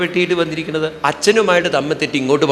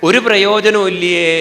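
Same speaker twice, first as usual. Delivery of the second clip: slow, loud and higher, each word leaned on; mocking emphasis; 5 semitones higher, 6 dB louder than usual. Real, not acted. Speaker mocking selfish love to the audience, slow loud emphasis. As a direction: drag it out with mock outrage.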